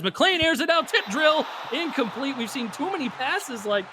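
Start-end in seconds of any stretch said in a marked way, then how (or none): 0.0-2.4 excited call. Real, not acted. Play-by-play man calling tipped pass, excited higher shout. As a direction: yell out the tip drill live.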